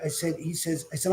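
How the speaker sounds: ordinary delivery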